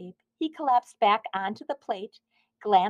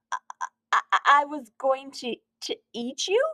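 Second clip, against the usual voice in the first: shocked stammer; 4 semitones higher than usual. A narrator's reading. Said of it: "he replied in shock".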